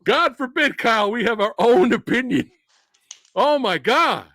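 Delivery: loud, high sarcastic indignation; mock outrage.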